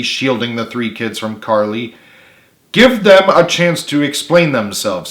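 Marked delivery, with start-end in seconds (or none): 2.7-5.1 stern. A narrator's reading. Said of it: "said Richard sternly".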